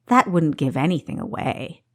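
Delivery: ordinary delivery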